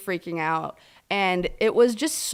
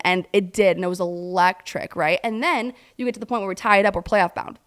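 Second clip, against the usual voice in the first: ordinary delivery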